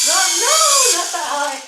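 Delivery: high, loud panicked yell; alarmed shout. Real, not acted. Same words, louder.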